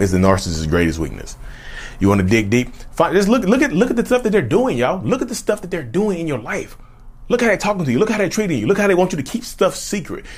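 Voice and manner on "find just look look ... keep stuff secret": much higher, pressing voice; urgent high appeal. Real, not acted. Urging viewers, a high insistent plea to look.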